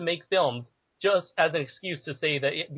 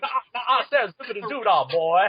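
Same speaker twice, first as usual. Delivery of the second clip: higher Southern rooster bluster; Foghorn Leghorn; 4 semitones higher than usual. Acted. Co-host riffing on the cartoon, blustering rooster voice. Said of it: "I'm picturing Foghorn Leghorn".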